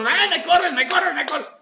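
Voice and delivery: hammed-up wounded whine; whiny victim. The other person complaining of being thrown out. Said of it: "Y el otro".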